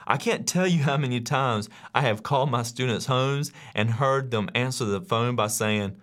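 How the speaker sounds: normal talking voice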